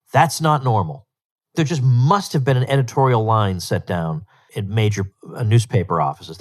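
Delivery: normal talking voice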